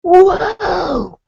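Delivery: high crying-out whoa, the last one loud; hammed-up alarm at the plate; shocked waitress. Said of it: "And she's like".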